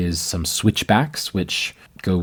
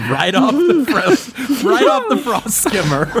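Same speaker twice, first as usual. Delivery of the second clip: high-pitched laughing through words; 4 dB louder than usual. Real, not acted. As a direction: laugh while getting the words out. GM laughing at joke.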